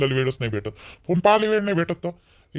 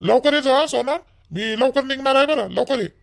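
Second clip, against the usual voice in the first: calling out; 8 semitones higher, 3 dB louder than usual. Acted. Calling after her as he leaves.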